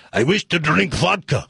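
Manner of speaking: put-on all-over-the-place Russian joke accent; mixed-up Russian accent. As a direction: do a comic bad Russian accent. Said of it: "this Russian accent's gonna sound like".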